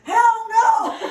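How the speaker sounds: high, loud refusal shout; defiant yell. Retold or imitated. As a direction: scream back that you won't. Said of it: "And I'm like".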